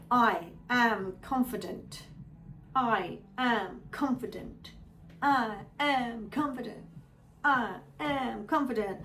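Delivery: slow, spaced-out affirmation; measured meditative chant; mantra recitation. Shown as a demonstration. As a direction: repeat it slowly, word by word.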